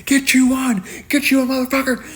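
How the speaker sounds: high screaming yell in his face. Retold or imitated. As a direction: scream it nose to nose to scare him. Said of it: "was screaming".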